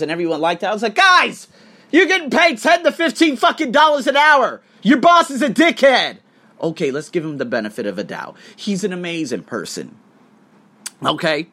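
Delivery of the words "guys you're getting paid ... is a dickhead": high, loud exasperated rant; indignant shouted outburst; outraged shout. Retold or imitated. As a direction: yell it at them like a fed-up friend. Retelling his outraged reply to post.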